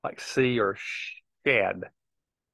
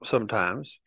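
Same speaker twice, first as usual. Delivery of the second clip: ordinary talk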